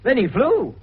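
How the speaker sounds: the baffled inspector explodes at the news; exasperated outburst. Acted. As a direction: blurt it out in disbelief, slowing on the last words. Exasperated outburst at impossible crime.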